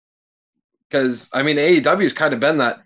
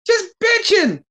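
exasperated much higher; slower than usual. Real, not acted. Exasperated rant about Page.

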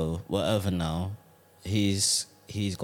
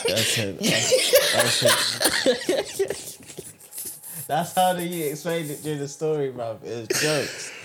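laughing hard, pitched way up; 9 semitones higher, 6 dB louder, slower than usual. Real, not acted. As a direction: laugh through the words. Cracking up at the story.

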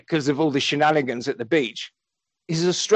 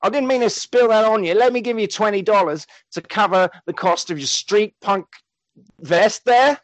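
higher-pitched mock-Australian apology; botched London accent drifting into Australian; Gary's Australian-sounding accent; 5 semitones higher than usual. Gary offering twenty dollars to a punk, Australian-sounding accent. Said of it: "your accent makes him sound Australian".

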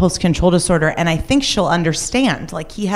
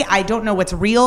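ordinary talk

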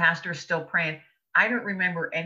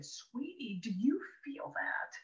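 breathy whisper, slow and intimate; hushed whisper; 8 semitones higher, 10 dB quieter, slower than usual. Retold or imitated. Mother whispers to her daughter during church prayer, whispering slowly. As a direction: lean in and whisper it to your girl mid-prayer.

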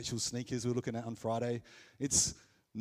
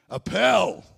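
much louder and higher, mock-ecstatic cry; huge, hammed-up burst of joy; exultant shout; 9 semitones higher, 16 dB louder than usual. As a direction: shout it like you've found treasure. Climax of acted joyful outburst.

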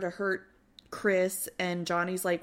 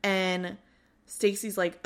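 usual speaking voice

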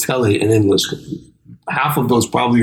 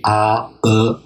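ordinary talk

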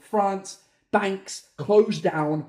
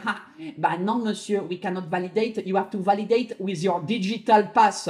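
hammed-up French accent. Banking system refusing the payment, mock French accent. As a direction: put on a fussy French official's accent. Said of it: "I imagine it speaking in a French accent".

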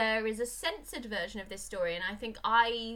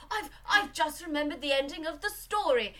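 higher, fretful prim voice; worried husband; 8 semitones higher, 5 dB louder than usual. Retold or imitated. Podcaster voicing the anxious husband, high and fretful. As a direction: warn her nervously, high. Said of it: "he's like".

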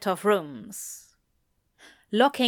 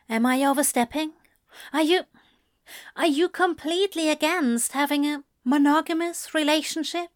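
high, quick angel questions; tentative angel; 7 semitones higher, faster than usual. Acted. Angel asking demon for commitment, high and tentative. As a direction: ask haltingly, pitched up, then hurry on.

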